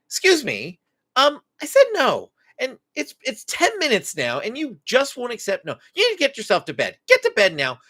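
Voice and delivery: imitating a parent losing patience; annoyed yelling parent. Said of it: "you start raising your voice and yelling".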